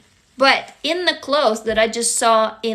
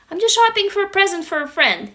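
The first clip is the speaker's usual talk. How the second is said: higher, breezy innocent excuse; innocent cover story; 7 semitones higher, faster than usual. Pretend. Shopper covering to a curious stranger, breezy and innocent. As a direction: play it casual, nothing to see here. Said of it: "you just like".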